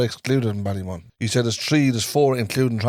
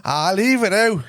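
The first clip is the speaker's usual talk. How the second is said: slow, higher mock Dublin accent; exaggerated Dublin drawl, pitched up; 5 semitones higher, slower than usual. Shown as a demonstration. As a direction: drag it out like a put-on Dubliner. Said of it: "do you wanna hear his dub accent?".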